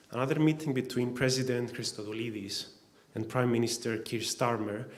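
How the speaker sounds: ordinary talk, nothing marked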